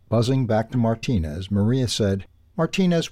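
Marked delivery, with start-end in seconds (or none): 2.6-3.1 much higher bright call of a name. A narrator's reading. Hummingbird Maria calling the dog over, high and bright. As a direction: sing out his name to catch his ear.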